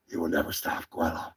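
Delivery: ordinary talk, nothing marked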